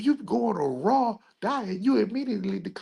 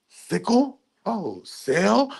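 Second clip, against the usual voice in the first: ordinary talk, nothing marked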